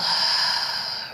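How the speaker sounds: long sighing breath out; audible exhale. Shown as a demonstration. Guide demonstrating the exhale for listeners, audible sighing exhale. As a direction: let the breath go in one long sigh. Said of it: "and exhale".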